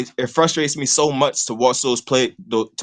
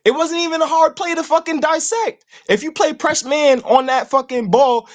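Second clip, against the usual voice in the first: high-pitched, incredulous venting; real frustration, pitched high; exasperated rant; 11 semitones higher than usual. Real, not acted. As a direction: vent in disbelief, voice pitched way up. Exasperated rant at a player's mistake.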